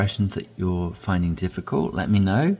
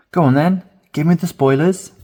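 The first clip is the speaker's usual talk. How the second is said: much higher and louder, begging to hear; eager pleading; 8 semitones higher, 7 dB louder than usual. Shown as a demonstration. Friend eagerly begging for spoilers, high and loud. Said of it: "this final expression down the bottom".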